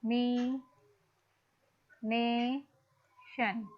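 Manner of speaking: "एक जय मी ने शन" said as separate syllables with pauses between. Shown as a demonstration. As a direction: break the word into its five syllables, one by one. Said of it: "कैसे stress और unstress mark करोगे".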